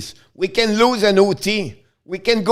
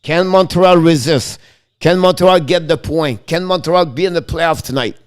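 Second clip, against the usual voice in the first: dramatic commentary. Acted.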